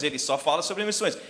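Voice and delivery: ordinary talk, nothing marked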